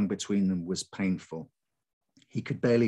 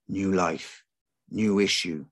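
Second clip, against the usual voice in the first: usual speaking voice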